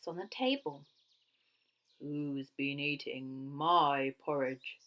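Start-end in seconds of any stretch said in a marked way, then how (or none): 2.0-4.8 low, slow booming bear voice; gruff Father Bear. A narrator's reading. Big Father Bear demanding who ate his porridge, gruff boom. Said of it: "in his great gruff voice".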